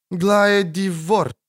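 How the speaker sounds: high, slow voice like a breath of wind; airy dryad voice. A narrator's reading. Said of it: "Голос, словно дуновение ветра".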